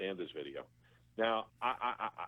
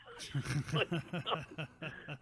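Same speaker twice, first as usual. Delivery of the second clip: real laugh; 5 semitones higher, 4 dB quieter than usual. Real, not acted. Laughing at the story.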